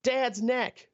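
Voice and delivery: lightly voiced kids egging each other on; kids' voice. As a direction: play the excited nieces and nephews. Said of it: "they're like".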